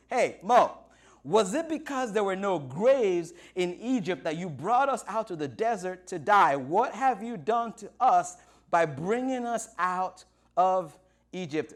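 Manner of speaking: loud, indignant crowd complaint; hammed-up accusing outcry; panicked, complaining Israelites. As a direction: confront your leader in loud disbelief.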